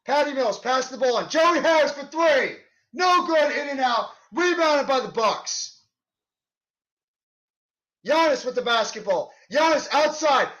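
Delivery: rising, excited call of each play; live play-by-play. Acted. Fan calling the Nets game play by play, excited. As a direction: call the action as it happens.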